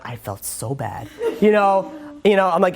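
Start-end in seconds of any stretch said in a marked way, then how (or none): 0.1-1.1 hushed, slow, sorry murmur; a quiet slow confession of feeling bad; mock remorse. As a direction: sink into guilt, softer and slower.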